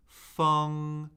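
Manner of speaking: "Feng" held high and flat; first tone. Shown as a demonstration. Teacher cycling four tones on a syllable, "Feng" high and flat. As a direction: say "Feng" on a high, level pitch. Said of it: "Let's do the four tones".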